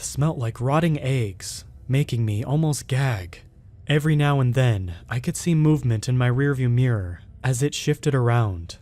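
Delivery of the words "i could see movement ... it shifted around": plain reading voice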